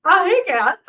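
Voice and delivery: laughs through a bright hello; a giggly hello that breaks into laughter; laughing greeting. Real, not acted. Greeting friend Cass on the live stream, laughing.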